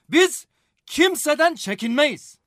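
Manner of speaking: slow, loud, emphatic declaration; proud, forceful and drawn out; defiant boast. Acted. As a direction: raise the voice and weigh every word.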